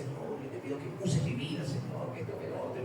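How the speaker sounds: breathy whispered plea to God; whispered prayer. Retold or imitated. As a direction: pray under your breath, pleading. Retold whispered prayer in tears.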